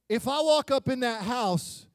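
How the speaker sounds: ordinary delivery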